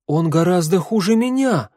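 high, indignant protest; outraged counter-accusation. A narrator's reading. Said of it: "возмутилась Гвен".

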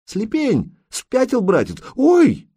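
grabbed from behind, cry out in shock; startled cry. Acted. Girl seized in the ravine cries out, startled and high. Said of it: "девка вскрикнула".

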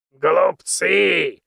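put-on loud, scornful old man shout; angry outburst. A narrator's reading. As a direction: spit the word out in anger. Mentor angrily bursts out.

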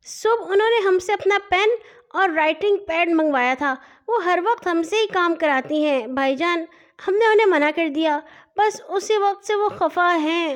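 put-on high, childlike little sister; little girl's voice. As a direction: play a cheeky little girl teasing her brother. Nanhi complains about her sister. (ننھی بچی کی باریک، شوخ آواز)

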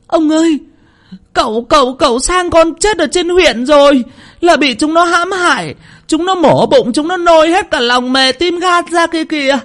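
put-on high, loud boy's cry, breathless with horror; wailing cry. Tèo crying out to the old men that the young master is dead, high and loud. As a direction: howl out the terrible news in one rush. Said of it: "gào lên".

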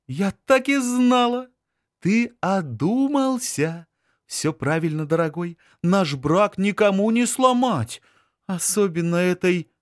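высокий торжествующий женский голос; звонко торжествует, выше обычного; triumphant woman. Acted. Anzhela voiced, triumphant. (торжествующая жена)

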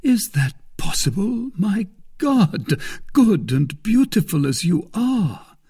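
high, loud feigned astonishment; affected surprise. A narrator's reading. Suitor flattering lady who has an enemy, astonished for show. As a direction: exclaim as if you can hardly believe it. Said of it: "affecting surprise".

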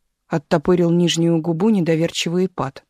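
ordinary delivery, nothing marked

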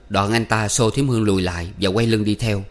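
usual speaking voice

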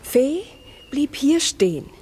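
slow eerie whisper; slow hushed whisper. Acted. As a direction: whisper it slowly, as if she's still there. Eerie recollection at the spot.

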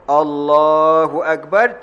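Allahu Akbar drawn out slowly; slow proper takbir. Shown as a demonstration. Preacher demonstrating correct takbir, slow and drawn out. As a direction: stretch Allahu Akbar calmly. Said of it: "takbiratul ihram dulu".

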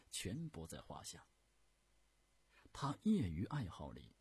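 ordinary delivery, nothing marked

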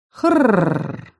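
short rasping growl of assent; confirming monster growl. Acted. Arakarna growls a yes to the heroine. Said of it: "подтвердила Аракарна".

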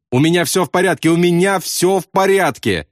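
high, insistent cry; yelling. A narrator's reading. Bombardier to panicking pilot, high insistent cry. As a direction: call it out high, twice. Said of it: "заорал Яссарян".

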